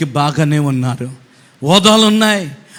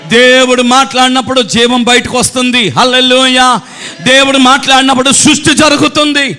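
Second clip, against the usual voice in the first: higher and louder, building to shouted hallelujahs; full-force preaching; 12 semitones higher, 9 dB louder than usual. Acted. Preacher to the congregation about God's word, building to a shout. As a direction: preach it out, rising with each line.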